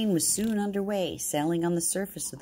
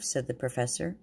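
usual speaking voice

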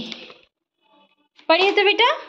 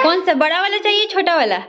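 put-on high cooing offer to a toddler; sweet sing-song, coaxing her to choose; mother offering dolls to toddler, high coo; baby talk; 7 semitones higher than usual.

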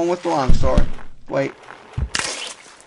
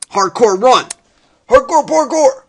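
loud yell climbing very high; hyped shouting; 9 semitones higher, 8 dB louder than usual. Pretend. Player charging through the level, hyped rising shout. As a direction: shout it like a battle cry, rising. Hyped battle cry while playing.

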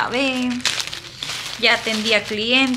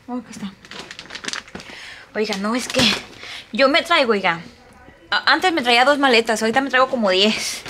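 ordinary talk

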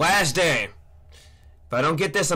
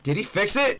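ordinary delivery

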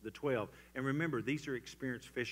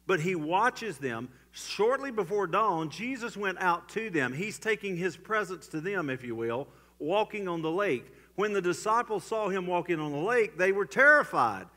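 loud, higher, climbing toward the terror; swelling preacher's cry; preaching at full force; 7 semitones higher, 9 dB louder than usual. Acted. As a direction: drive the story home from the pulpit. Preaching the lake scene.

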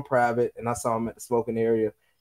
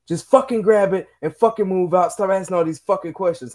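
mock shout; 6 semitones higher than usual. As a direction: bark it out like a fed-up sergeant. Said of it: "screams out".

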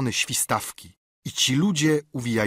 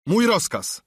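short, curt command, pitched up; curt order; 9 semitones higher, 4 dB louder than usual. A narrator's reading. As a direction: cut him off. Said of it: "rzekł krótko kapitan". (krótki, stanowczy rozkaz)

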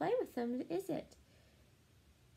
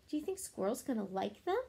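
ordinary delivery, nothing marked

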